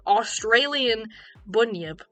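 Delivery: slow, drawn-out Australian accent. Shown as a demonstration. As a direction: stretch Australian out in an Aussie accent. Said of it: "I'm saying this with an Australian accent".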